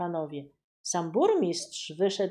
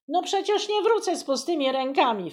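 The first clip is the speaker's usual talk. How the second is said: despairing; 7 semitones higher, 4 dB louder, faster than usual. Acted. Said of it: "wpadł w rozpacz kuchcik".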